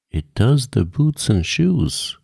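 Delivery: solemn. A narrator's reading. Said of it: "replied very solemnly".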